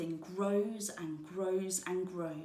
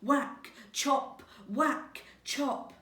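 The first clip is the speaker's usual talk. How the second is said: higher and louder, like hard axe blows; loud chopping sounds; 6 semitones higher, 5 dB louder, much slower than usual. A narrator's reading. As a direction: hit every word like a swing of the axe.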